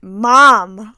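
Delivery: loud, imitated reproach; scandalized daughter. Daughter scolding mother for her remark, loud reproach. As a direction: call it out, mock-appalled.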